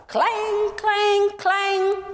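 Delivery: high, ringing clang clang clang; clanging bell. Acted. Narrator imitating the fire truck bell, high and ringing.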